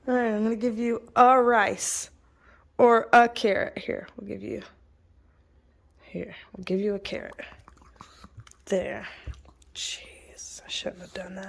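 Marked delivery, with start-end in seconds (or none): 3.8-11.5 quiet whispered giving in; hushed whisper. Real, not acted. Giving the puppy a carrot, hushed whisper. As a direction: whisper while handing over.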